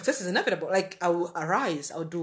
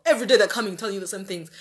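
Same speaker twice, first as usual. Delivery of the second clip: usual speaking voice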